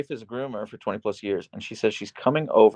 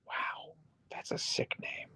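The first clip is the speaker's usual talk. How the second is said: whispered aside; 17 dB quieter, slower than usual. Real, not acted.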